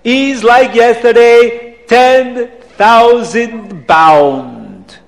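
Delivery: put-on drawn-out, accented price call; hint of a boastful Abdallah. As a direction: announce the price grandly, stretching the words. Auctioneering Abdallah in story.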